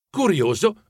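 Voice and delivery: ordinary delivery, nothing marked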